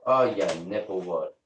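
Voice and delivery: normal talking voice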